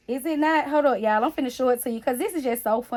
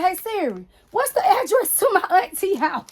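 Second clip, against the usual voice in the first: put-on child asking Siri; 8 semitones higher, 5 dB louder than usual. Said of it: "Then she said".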